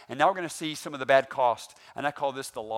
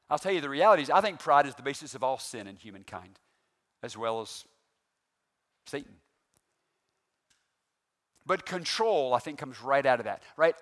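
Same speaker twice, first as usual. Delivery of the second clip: normal talking voice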